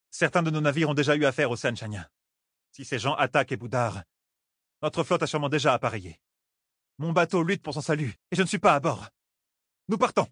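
eager to rejoin her ship; sea folk captain. Acted.

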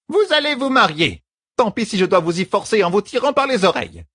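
very high scolding character voice; stern bossy servant. A narrator's reading. Servant ordering the lovers to marry, stern.

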